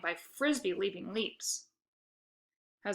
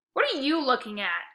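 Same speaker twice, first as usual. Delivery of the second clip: growled; 4 semitones higher, 4 dB louder than usual. A narrator's reading.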